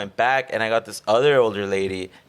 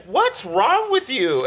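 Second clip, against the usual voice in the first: mock scolding; 13 semitones higher, much slower than usual. Examiner scolds after train tracks.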